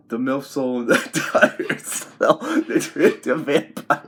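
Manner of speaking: talking through real laughter; cracking up. Real, not acted. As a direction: laugh while trying to finish the story. Cracks up telling the dream.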